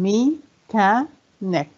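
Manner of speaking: "Mechanic" very slowly as me, cha, nic; syllable by syllable. Shown as a demonstration. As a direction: leave gaps between the three parts of "Mechanic". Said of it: "كم عدد المقاطع".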